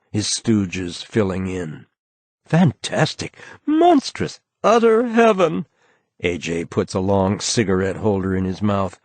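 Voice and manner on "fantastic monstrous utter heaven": high, gushing society voice, much higher; elegant tones. A narrator's reading. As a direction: swoon over each word like a flamboyant host. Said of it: "begins talking in elegant tones".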